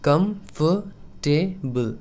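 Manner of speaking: Comfortable drawn out, KUMF-tuh-bul; very slow demo. Shown as a demonstration. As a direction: stretch comfortable slowly. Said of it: "correct pronunciation होगा".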